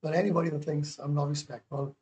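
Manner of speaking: normal talking voice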